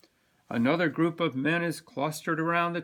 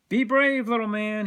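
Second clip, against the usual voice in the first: much higher, louder pep; upbeat encouragement to a little boy; encouraging shopkeeper; 8 semitones higher, 7 dB louder than usual. Acted. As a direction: cheer the boy on. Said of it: "he says".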